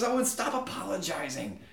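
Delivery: high-pitched griping deity; exasperated God. Acted. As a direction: complain like a God sick of apologies. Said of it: "he says".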